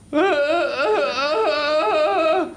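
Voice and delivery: high, slow, laughing strained squeak; frying egg's voice. Pretend. The egg in the pan complains it's too hot.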